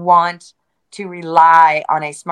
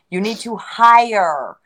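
"hire" stretched out, slow and higher; stress on hire; 4 semitones higher, much slower than usual. Real, not acted. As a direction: drag out and punch "hire". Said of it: "Emphasis on hire".

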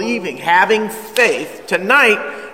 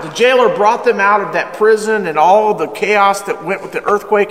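normal talking voice

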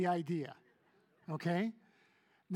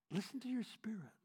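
whisper; 2 semitones higher, 4 dB quieter than usual. Acted. Intimate sermon moment.

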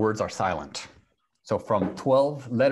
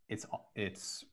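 normal talking voice

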